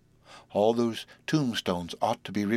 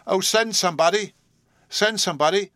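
high, loud desperate cry; 9 semitones higher, 5 dB louder than usual. Acted. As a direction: call out for help, higher and louder. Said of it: "she cried aloud".

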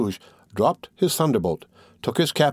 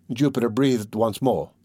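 plain reading voice

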